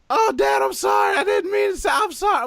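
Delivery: high, loud imitation of a flustered kid; mock panicked apology. Daughter caught cursing apologizes to dad, imitated high panic. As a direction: blurt it like a child caught out.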